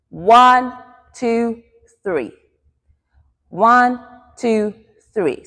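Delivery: spaced count, high start falling on three; rhythmic dance count. Shown as a demonstration. Dance teacher counts three movements for the class, in time. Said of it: "So three movements".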